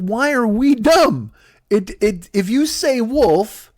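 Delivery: slow, high, spelled-out reasoning; exasperated family talking down to George; patient explaining. Acted. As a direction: lay it out slowly like to a child.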